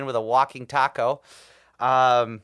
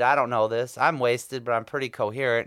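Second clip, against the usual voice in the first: ordinary talk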